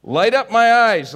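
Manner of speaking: high, loud, slow plea; psalm cry. Acted.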